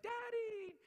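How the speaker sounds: put-on high, loud squeal of a toddler greeting daddy; imitating his little daughter. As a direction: squeak it like a small child running in. Daughter greeting him at home.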